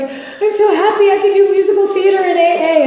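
high, loud gushing joy through tears; overjoyed and swept away, pitched high; breathless high-pitched happiness. Retold or imitated. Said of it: "I'm like".

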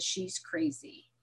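slow, whispered, unvoiced; hushed gossip whisper. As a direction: breathe it like a scandal. Family reporting her to others.

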